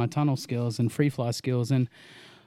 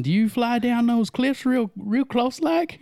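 mock Southern accent; 10 semitones higher, slower than usual. Mimicking a dumb question about cliffs in a high, slow Southern accent. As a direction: stretch it out like a clueless Southern local. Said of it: "you have a pretty good Southern accent".

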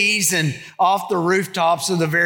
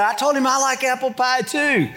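put-on 1 child's voice; 6 semitones higher than usual. Child's reply retold.

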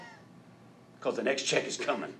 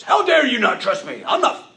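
high, loud hammed-up rebuke; hammed-up furious shout; mock angry shout; 14 semitones higher, 14 dB louder than usual. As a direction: bellow it as a mock outraged Jesus. Mock version of Jesus.

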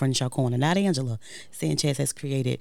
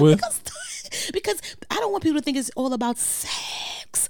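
squeaky embarrassed high voice; high pitched; 11 semitones higher than usual. Real, not acted. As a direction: pitch jumps way up, flustered.

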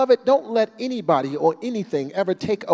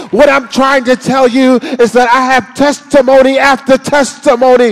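loud, fervent preacher cadence, much higher; preaching; 7 semitones higher, 12 dB louder than usual. Acted. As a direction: preach it with full fire. Preaching at full force.

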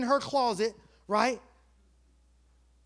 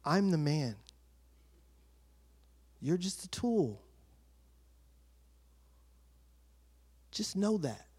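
low, quiet, measured reproof; understated divine authority; voice of God; 5 semitones lower, 4 dB quieter than usual. Retold or imitated. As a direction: set them straight calmly, with long pauses. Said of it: "And he's like".

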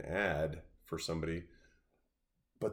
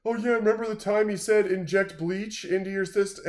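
much higher and louder, snide imitation; mocking quote; 11 semitones higher, 7 dB louder than usual. Imitating a Trump hater at a pool party, mocking. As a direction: ham up a gloating Trump hater. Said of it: "they were like".

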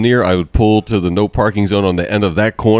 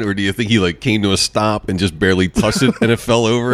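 ordinary delivery

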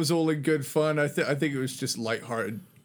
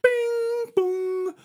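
very high, slow Birmingham tannoy voice; chime, then drawn-out squeaky train announcement; mock station announcer; much slower than usual. Birmingham station announcement proving his girlfriend wrong, mock announcer voice. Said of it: "the big Birmingham train station at the time".

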